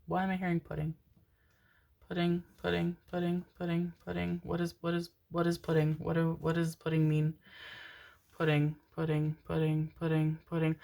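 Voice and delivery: softly repeating, under the breath; quiet musing. Real, not acted. Reader puzzling over a message she hears, quiet musing. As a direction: turn the word over quietly to yourself.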